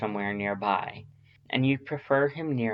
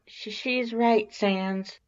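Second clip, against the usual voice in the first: high, stammering, timid lizard scientist; nervous Alphys voice; 11 semitones higher, slower than usual. A narrator's reading. Alphys interrupts Sans, nervous high stammer. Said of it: "Alphys speaks up suddenly".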